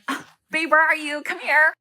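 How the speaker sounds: high, loud, excited call for babe; hammed-up eager girlfriend; clingy during ovulation. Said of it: "Because ovulation phase, I'd be like".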